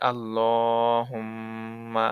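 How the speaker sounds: আল্লাহুম্মা drawn out very slowly with ghunnah on the mim; Quranic recitation. Shown as a demonstration. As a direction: chant আল্লাহুম্মা slowly, nasal hum on mim. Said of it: "ঠিক আছে?".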